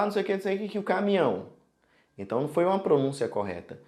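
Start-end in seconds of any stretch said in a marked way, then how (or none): 0.0-1.5 tossed-off, higher mumbling before caminhão; a careless signer rushing through a sentence; careless mock chatter. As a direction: rattle it off carelessly, blurting caminhão. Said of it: "você fala".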